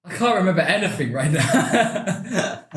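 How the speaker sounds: high, slowed laughing words. Real, not acted. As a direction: laugh through the sentence. Overwhelmed by hot sauce.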